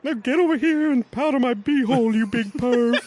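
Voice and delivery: very high, loud cranky granny; grandma voice. Acted. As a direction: screech it like a bossy old grandma. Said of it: "I'm gonna channel my grandma".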